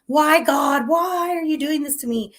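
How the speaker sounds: high, loud anguished cry to the heavens; desperate plea to God. Retold or imitated. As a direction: wail it up at God in despair. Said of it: "what you want to ask instead of".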